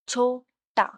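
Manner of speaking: "छोटा" broken into छो, टा; syllable by syllable. Shown as a demonstration. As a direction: pause between the two syllables of "छोटा". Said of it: "टू syllables". ("छोटा" रुककर, छो-टा)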